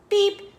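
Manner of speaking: high horn beep; truck beep. A narrator's reading. Little Blue calling all aboard, high beep.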